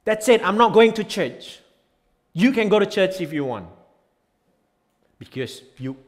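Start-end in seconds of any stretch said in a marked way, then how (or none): 0.0-3.7 mock huff. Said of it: "you don't go like".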